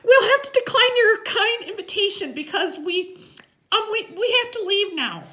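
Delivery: put-on very high, loud kid voice; nervous, squeaky-high little kid; chattery high. A narrator's reading. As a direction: stammer a polite excuse way up high. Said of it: "his voice is chattery high".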